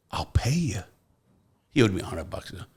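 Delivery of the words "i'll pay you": whispered. Retold or imitated. Cellmate whispering a promise.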